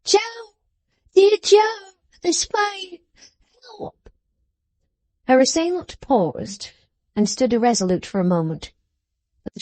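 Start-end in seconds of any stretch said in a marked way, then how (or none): 0.0-4.1 put-on high-pitched girl hailing her rescuer; very high, excited acted cries; ecstatic calling out. A narrator's reading. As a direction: call to him in joyful desperation. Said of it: "cried the girl in an ecstasy".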